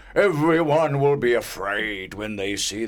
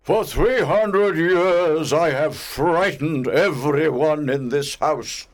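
angry ghost; 10 semitones higher, 5 dB louder than usual. A narrator's reading. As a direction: rant like an outraged old spirit. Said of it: "He was very angry".

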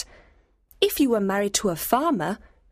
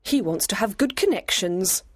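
put-on high-pitched, cool young lady; cold; 11 semitones higher than usual. A narrator's reading. Lady dismisses her friend's suitor, cold. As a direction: dismiss him coolly. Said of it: "said Emma coldly".

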